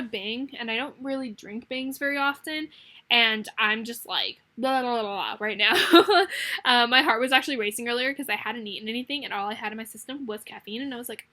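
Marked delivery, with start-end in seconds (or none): 5.8-6.6 bright burst of laughter, higher and louder; sudden giggle at her own chatter; real laugh. Real, not acted. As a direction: laugh out at yourself.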